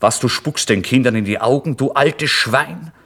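furious landlord shouting, dragging out the last words; angry shout. Acted. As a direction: yell at the old man in outrage. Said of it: "Er war rot im Gesicht und rief".

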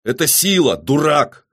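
high, loud angry outburst; barked. A narrator's reading. Killer defends his motive to the rival, barking. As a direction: bark it at a fool. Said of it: "рявкнул Доген".

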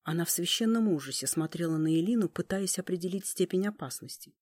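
plain reading voice

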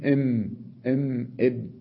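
إم إم إب, m sounds then a stopped b; إم إم إب voiced in isolation; letter sounds. Shown as a demonstration. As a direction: compare إم with إب at the lips. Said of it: "الباء مع الميم".

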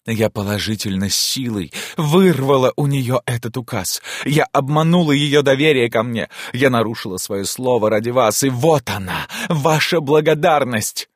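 high, agitated tirade; indignant reproach. Acted. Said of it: "крикнула она с глубоким возмущением". (гневный, возмущённый упрёк)

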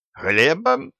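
high, rising surprised question. Acted. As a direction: ask it in real surprise. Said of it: "удивился правитель".

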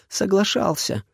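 put-on high, insistent girl's retort; stubborn girl. A narrator's reading. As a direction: insist you're right. Heroine retorts.

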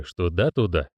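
plain reading voice

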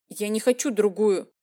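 plain reading voice

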